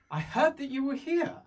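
high, lilting voice, far from his own; airy high elf greeting. Acted. Elf greets the newcomer in a lilting high voice. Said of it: "He says".

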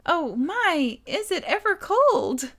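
high, overdone attempt at coquetry; awkwardly botched sweet line. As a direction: try to be cute and overshoot upward. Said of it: "did I say it wrong?".

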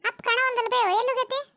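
high-pitched daughter voice; daughter asking. Acted. Daughter asks mother where Father went, high-voiced role.

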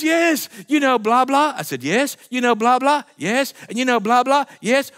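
high, loud blah blah; sing-song mock yeses; mock chatter. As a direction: parody his rambling. Mocking chatter.

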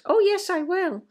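mock meek child agreeing; eager compliant child. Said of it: "in answer to parent".

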